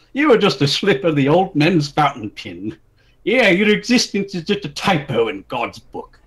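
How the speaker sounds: mock-grand cruel insult. Taller man insulting the princess, mock-grand and loud. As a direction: show him how it's done. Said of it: "this is how you do it".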